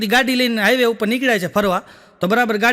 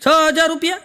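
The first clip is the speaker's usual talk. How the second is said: shocked question; 6 semitones higher than usual. Retold or imitated. Shocked at the price.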